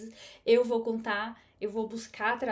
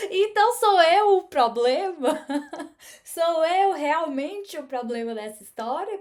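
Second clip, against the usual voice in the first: loud, high, incredulous laugh through the words; laughing disbelief; 5 semitones higher, 9 dB louder than usual. Real, not acted. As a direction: exclaim it, half laughing at yourself. Realizing she's the problem.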